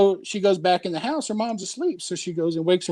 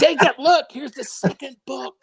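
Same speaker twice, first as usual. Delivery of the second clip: eager; 8 semitones higher than usual. Retold or imitated. Dad showing son his book.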